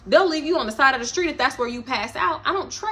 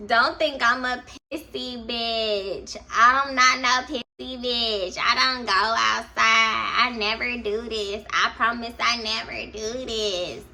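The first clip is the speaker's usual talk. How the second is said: put-on slow, drawn-out drunk plea; slow drunk pleading; much slower than usual. Drunk friend pleading while peeing.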